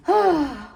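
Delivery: theatrical exasperated sigh; dramatic sigh. Acted. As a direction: sigh like a suffering lover. Acting Romeo before he answers.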